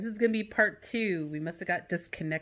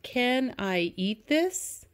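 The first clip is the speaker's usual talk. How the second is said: slow and higher, rising at the end; slow read-aloud; 4 semitones higher, much slower than usual. Shown as a demonstration. Teacher reads the sample sentence to students slowly, rising. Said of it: "So make sure you're reading".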